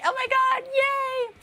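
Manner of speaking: put-on squealing very high childish yay; mock excited child. Streamer mimics niece's puzzle win in a squealing high yay.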